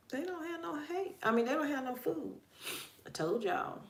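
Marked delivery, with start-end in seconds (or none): 0.1-2.4 higher-pitched mock child's complaint; imitated child. Child telling mother the animals lack food, imitated higher voice. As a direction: pipe up like a kid reporting a problem. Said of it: "she's like".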